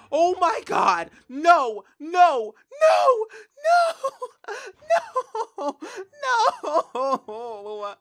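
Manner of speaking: high, piercing shrieks of disbelief; real anguished shouts, half laughing; real screaming. Real, not acted. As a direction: scream no over and over as the pet dies. Pet dies on screen.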